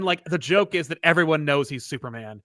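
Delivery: usual speaking voice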